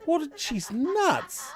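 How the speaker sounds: high incredulous what then she's nuts; shocked disbelief. Retold or imitated. Reacting to an old lady's threat, high incredulous. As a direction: say it stunned. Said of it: "I was like".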